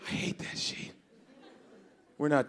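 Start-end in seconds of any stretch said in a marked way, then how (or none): 0.1-0.9 whispering. Real, not acted.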